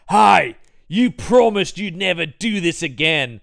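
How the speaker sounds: mock porno line; porn actor voice. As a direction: read it like bad film acting. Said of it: "he goes".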